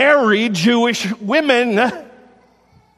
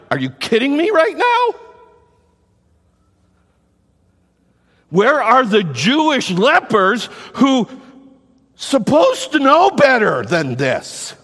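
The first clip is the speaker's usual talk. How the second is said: incredulous Jesus; 5 semitones higher, 3 dB louder than usual. Retold or imitated.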